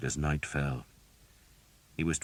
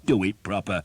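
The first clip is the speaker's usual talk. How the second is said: firm scolding at normal pace; first telling of the order; 4 semitones higher, 7 dB louder than usual. A narrator's reading. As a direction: say it sharply once. First take before emphatic repeat.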